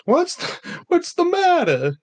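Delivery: high, slow mock Boston accent "what's the matter". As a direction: stretch it out like a Bostonian.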